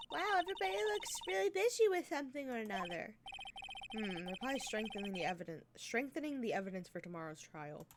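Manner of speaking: ordinary talk, nothing marked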